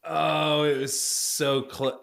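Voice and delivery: usual speaking voice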